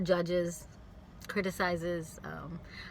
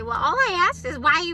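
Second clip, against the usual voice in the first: high, loud defensive retort; mock indignant reply; defensive quote; 10 semitones higher, 10 dB louder than usual. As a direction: play the offended asker. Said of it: "they might say".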